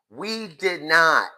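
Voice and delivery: slow, loud, drawn-out denial; slow emphatic. Real, not acted. Vlogger insisting on her own era, slow and emphatic. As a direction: stretch each word out slowly to insist.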